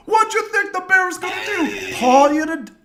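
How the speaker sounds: very high, loud hammed-up jeer; mocking shout. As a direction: shriek the taunt, imitating. Retold friend's jeering.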